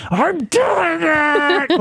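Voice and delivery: hushed yell, stretched slow; whisper yelling. Shown as a demonstration. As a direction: overreact in a whispered shout. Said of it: "give her a whisper yell and be like".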